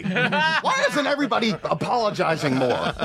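very high-pitched comic outrage; mock indignation. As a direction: play an entitled actor demanding apologies. Voicing a self-important actor.

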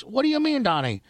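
high, shrill-pitched demand; shocked wife voice. Pretend. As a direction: voice shoots up in disbelief. Acted wife reacting.

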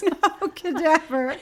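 high, slow, laughing repeat. Real, not acted. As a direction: giggle it out. Repeating friend's joke laughing.

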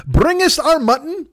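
card players mimicking the Earl, high and slow; mock lordly demand. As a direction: sing it out like a fussy old aristocrat.

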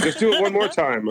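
high-pitched imitation of cajoling; mock coaxing. Photographers coax him once more.

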